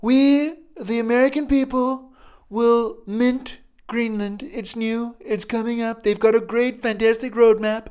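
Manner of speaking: high, loud put-on presidential hype voice; hammed-up president announcing a project; mock Trump pitch. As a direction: sell it like a grand salesman. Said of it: "He's like".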